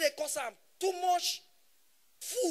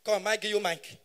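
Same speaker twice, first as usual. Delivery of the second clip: ordinary talk, nothing marked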